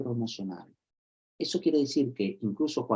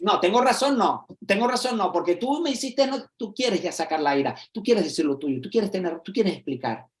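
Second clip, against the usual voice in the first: much higher, insistent protest; heated argument; 7 semitones higher than usual. Retold or imitated. Someone arguing with their partner, higher and insistent. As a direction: keep pushing your point, worked up. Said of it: "Y tú dices".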